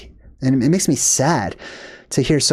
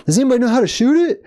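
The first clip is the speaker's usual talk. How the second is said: fast, much higher mock of a clueless asker; mocking question; 8 semitones higher, much faster than usual. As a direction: ridicule the question with a silly voice. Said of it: "I'm like".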